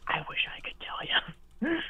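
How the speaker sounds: slow, hushed whisper; soft whisper with a rising end. Real, not acted. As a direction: whisper the admission, drawing it out. Repeats the admission in a whisper.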